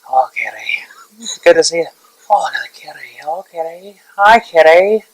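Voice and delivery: cat voice. Pretend. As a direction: greet like a cat would. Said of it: "I can greet in a cat voice".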